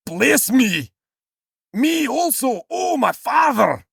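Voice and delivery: high, hammed-up anguished wail; bitter cry. Esau begging his father's blessing, mock bitter cry. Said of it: "cried with an exceedingly great and bitter cry".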